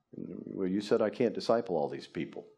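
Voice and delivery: ordinary delivery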